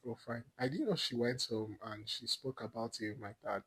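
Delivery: normal talking voice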